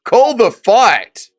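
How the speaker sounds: whoa after whoa, high and loud, then a slow call the fight; shouting at the screen as the fight ends; excited yelling. Real, not acted. Streamer watching a knockout live with his co-host, excited yelling.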